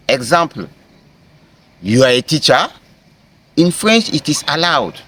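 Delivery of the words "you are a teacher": TEACHER lifted at the end as a question; rising question tone. Shown as a demonstration. French teacher turning a statement into a question, rising intonation. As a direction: ask it by raising the tone on "teacher". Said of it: "just by raising my tone".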